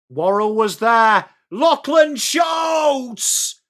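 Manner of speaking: building to a loud, drawn-out shout; excited goal call. Real, not acted. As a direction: shout the scorer's name high and long.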